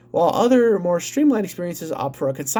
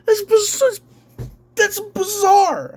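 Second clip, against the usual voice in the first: incredulous high outburst; 12 semitones higher, slower than usual. Real, not acted. Ranting viewer stunned by the CEO's greed, voice cracking high. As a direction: voice jumps way up in disbelief. Ranting about a rich CEO.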